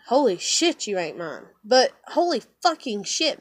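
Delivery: ordinary delivery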